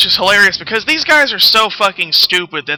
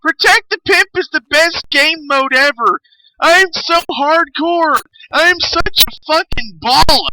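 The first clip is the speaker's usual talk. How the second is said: much higher, slow, whiny mock of forum fans; mocking whiny gamers; 10 semitones higher than usual. Mocking forum fans who demand the old mode, high whiny mock voice. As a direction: mimic them as bragging, spoiled kids. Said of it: "they're sitting there on the forums all day".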